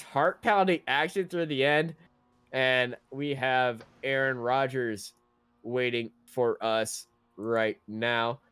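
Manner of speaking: usual speaking voice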